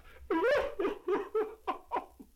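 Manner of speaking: high, loud hearty laughter; big belly laugh. Acted. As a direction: roar with laughter. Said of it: "He started to laugh".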